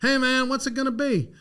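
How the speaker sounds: high, eager mock Randy voice; imitating assistant coach. Assistant coach on a payphone asking for the answer, high and eager. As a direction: pitch it up like an excited caller. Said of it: "calls me".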